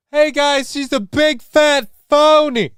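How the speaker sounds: high, drawn-out mocking phony; nasal-free high mock taunt; mock whiny accuser. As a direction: sneer it like a whiny heckler. Mocking critics of Harris.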